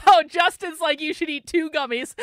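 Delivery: loud, high, between bursts of laughter; amused outburst. Real, not acted. Streamer relaying a viewer's gummy joke, highly amused.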